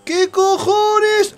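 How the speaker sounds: chillidos agudos y fuertes; grito de asombro. Real, not acted. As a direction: grita incrédulo, alargando el final. Exclama alterado durante el juego. (grito agudo de incredulidad)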